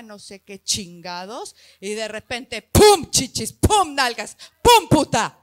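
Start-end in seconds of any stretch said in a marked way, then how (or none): 2.6-5.4 cada pum soltado fuerte como golpe; pum exclamado. Acted. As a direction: suelta los pum como explosiones. Efectos de crecimiento repentino. (gritando pum a golpes)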